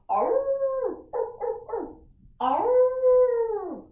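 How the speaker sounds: long, high held howl; dog howl. Shown as a demonstration. Teacher howling together with children, high dog howl. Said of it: "Let's howl together".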